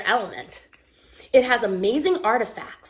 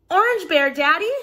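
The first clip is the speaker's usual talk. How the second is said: put-on high, loud eager child voice; child pleading to bring the bear; excited call; 6 semitones higher, 5 dB louder than usual. Child calling out to dad.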